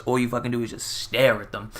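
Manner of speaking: ordinary delivery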